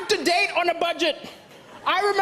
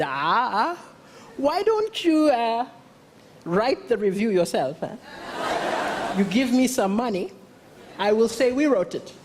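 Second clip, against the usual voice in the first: lightly voiced sly editor; 5 semitones lower than usual. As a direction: lightly play an editor angling for a bribe. Comic acts a bribe-seeking editor.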